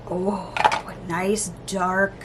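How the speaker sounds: normal talking voice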